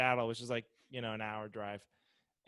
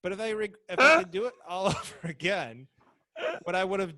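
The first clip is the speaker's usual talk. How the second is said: laughing; 6 semitones higher, 7 dB louder than usual. Real, not acted. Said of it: "aren't you glad".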